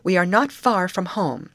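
high, emphatic reassurance as an order; commanding. A narrator's reading. Betty steadying her frightened friends, mild command. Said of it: "Betty commanded".

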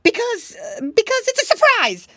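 high, loud stammering excuse; piggy boy caught out, blurting an excuse; flustered fib. Acted. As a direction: grab for a lie on the spot. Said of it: "was all Curly could think of to say".

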